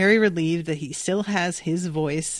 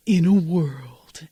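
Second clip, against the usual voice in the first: slow, lower movie-trailer announcer; 4 semitones lower, slower than usual. Acted. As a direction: drag it out like a trailer voiceover. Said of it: "that's kind of the voice that Dipper winds up with".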